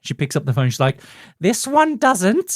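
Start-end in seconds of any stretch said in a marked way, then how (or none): 1.4-2.5 high, slow comic falsetto; drawn-out punchline in a TV cook voice; Julia Child parody. Acted. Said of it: "pretending basically to be Julia Childs".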